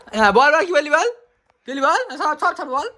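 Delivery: high, loud, insistent coaxing; eager urging. Real, not acted. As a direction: push him to spill it. Pressing friend to spill secret.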